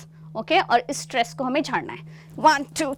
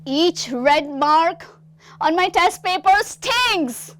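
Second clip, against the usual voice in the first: slow, high, drawn-out anguish; dramatic dialogue; 4 semitones higher, 3 dB louder, much slower than usual. Shown as a demonstration. Teacher demonstrating a street theatre line, slow and anguished. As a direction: stretch each word like a stage actor in pain. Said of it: "वो dialogue बोलता है".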